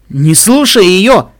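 high, loud, drawn-out warning; insistent. A narrator's reading. Potted plant warns Pinkamena, insistent. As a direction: urge it forcefully. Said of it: "настаивало растение в горшке".

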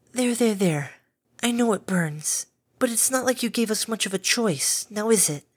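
calm a frightened beast kindly; gentle caretaker Fluttershy. A narrator's reading.

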